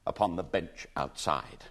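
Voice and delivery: plain reading voice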